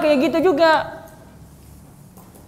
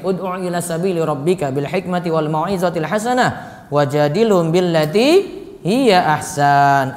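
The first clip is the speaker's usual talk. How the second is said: Quran recitation; 6 semitones lower than usual. Shown as a demonstration. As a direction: intone the verse like tilawah. Quoting a Quran verse.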